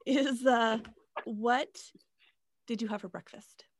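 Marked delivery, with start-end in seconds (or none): none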